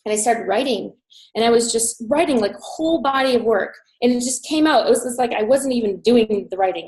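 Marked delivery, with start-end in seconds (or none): none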